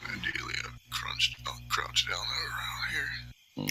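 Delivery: soft unvoiced whisper, slow at the end. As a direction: whisper it like sneaking into position. Sneaking up to the door.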